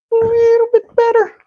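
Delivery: very high squeaky perfectionist voice; mock squeaky voice. To his viewers, mocking the perfectionist urge in a squeaky voice.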